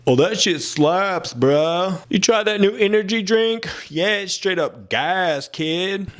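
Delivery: a hammed-up young slang-talker; mock youth slang. Mocking the younger generation's slang, hammed-up youth voice. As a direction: tease the kids' slang. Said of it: "new slang with the younger generation".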